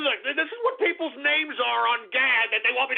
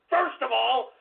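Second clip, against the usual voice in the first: ordinary delivery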